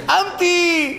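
high-pitched mock call. People spotting the shaven man and calling him, teasing. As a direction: call the name up high, drawn out. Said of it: "התחילו לקרוא לו שמה".

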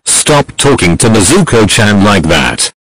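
shouting in another voice; loud defensive yell. Acted. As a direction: yell it protectively. Protective character yells in chat.

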